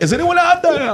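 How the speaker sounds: high comic cry for help; hammed-up distress call; stranded shipwreck survivor. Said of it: "I'm one of the guys on the boat".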